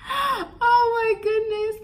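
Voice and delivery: high, slow, half laughing half crying. Real, not acted. Remembering the boss who never gave the raise, in a slow laugh-cry. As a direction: laugh through disbelief at it all. Reliving the boss's treatment.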